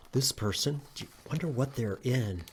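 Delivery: ordinary talk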